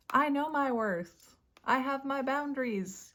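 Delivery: high, proud declaration; voicing the Queen of Swords. Tarot reader voicing the queen's confident stance. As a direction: declare her worth in a lifted voice. Card figure voiced as affirmation.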